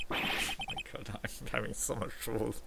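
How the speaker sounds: real giggling exasperation, barely voiced; helpless laughter. Real, not acted. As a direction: break up laughing while talking. Player cracks up over reading the dialogue.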